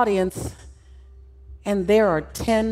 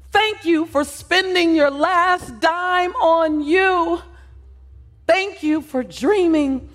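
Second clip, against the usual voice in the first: fervent thanks; 6 semitones higher than usual. Pretend. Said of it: "yells out".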